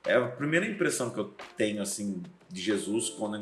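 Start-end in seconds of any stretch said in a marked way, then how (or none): none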